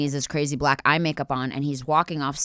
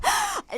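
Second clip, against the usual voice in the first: sharp high gasp; flinching gasp; breaking eye contact with a gasp; 3 dB quieter than usual. Real, not acted. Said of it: "I can't do it".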